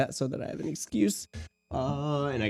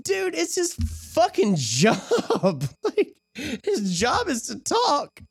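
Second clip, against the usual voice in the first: laughing retort; much slower than usual. Retold or imitated. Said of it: "I'm like".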